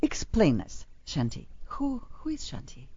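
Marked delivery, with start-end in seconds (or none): 1.6-2.9 soft, voiceless stage whisper; playful whisper. Acted. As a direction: hush it like a secret. Host teasingly asks about guest.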